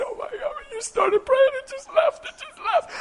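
very high, squeaky astonished voice; imitated amazement. Woman finds the pain left during prayer, high-pitched amazement. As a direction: play her stunned disbelief way up in pitch.

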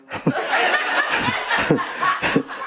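breathy high laugh; laughing at his own line. Real, not acted. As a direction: cut loose with a laugh. Laughs at the joke.

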